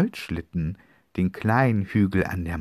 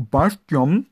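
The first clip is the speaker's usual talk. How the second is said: high, loud call of his name; 10 semitones higher, 11 dB louder than usual. Acted. Sister calls her brother's name across the table, loud.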